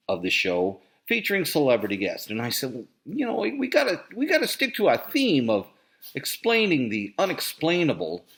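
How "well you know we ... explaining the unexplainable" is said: high mock voice. Quoting himself to producer, high.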